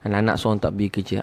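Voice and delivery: usual speaking voice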